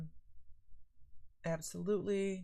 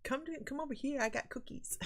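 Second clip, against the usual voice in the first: mock coaxing; 9 semitones higher than usual. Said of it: "And Lori's like".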